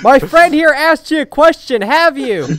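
high, loud, pushy demand; tough questioning. Acted. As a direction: lean on him for an answer. Pushing a stranger for an answer.

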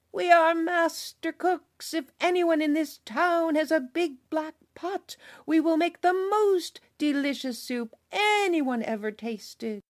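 high, loud call from the well's edge; shouting travelers. Acted. Travelers on the well shouting to the town, high and loud. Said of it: "and shouted".

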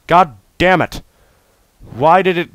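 ordinary talk

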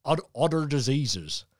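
lightly put-on Cornish accent; a hint of a Cornish country accent. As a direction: read it out in a broad Cornish accent. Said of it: "I have to break out the Cornish accent".

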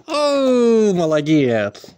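high, loud delighted praise; excited praise. Real, not acted. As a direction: cheer the dog loudly. Dog comes when called.

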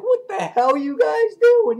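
very high, loud, slow yell; outraged yell. Retold or imitated. Father catches daughter with boyfriend, outraged mock shout. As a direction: shout in acted disbelief.

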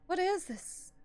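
usual speaking voice

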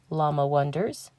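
ordinary delivery, nothing marked

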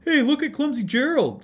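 high, loud mocking jeer; sneering. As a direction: jeer at him like a taunting crowd. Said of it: "the animals all sneered".

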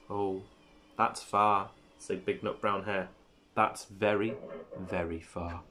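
low, soft murmur with pauses; hushed bedtime voice. Acted. Big hare over the dozing little hare, hushed.

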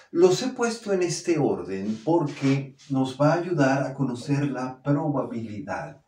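usual speaking voice

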